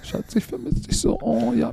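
breathy mock lovesick whisper, high; drunk dialing. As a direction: swoon like a lovesick spouse. Mocking partner's sweet talk.

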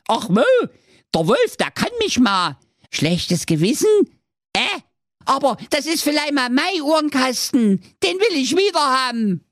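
sehr hohe, piepsige Zicklein-Stimme, leicht japsend; hoch und quäkig wie ein junges Zicklein; kleinstes Geißlein. Acted. Geißlein antwortet dem Kommissar. (hohes Geißlein-Gepiepse nach der Drehtür)

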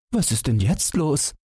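high-pitched wifely exclamation; woman calling out. A narrator's reading. Wife watches the chase, high astonished call. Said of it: "rief die Frau".

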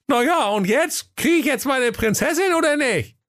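squeaky prince, shrewd and demanding; a sly little prince laying down terms; high squeaky prince. Acted. Hacke Peter. (piepsiger, gewitzter Prinz)

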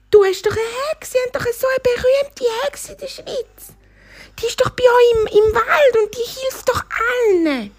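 very high-pitched, emphatic cheetah; excited cheetah. Acted. Cheetah recommends the famous witch, high and excited.

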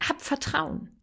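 soft reassurance. Real, not acted. Mother gently reassures listening parents, very soft. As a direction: say it gently, almost breathed.

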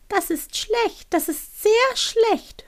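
high, very slow, worried owl; little owl voice. Acted. Owl worrying aloud to itself, high and drawn out. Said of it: "hörte sie die Stimme weiterreden".

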